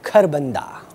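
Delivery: ordinary talk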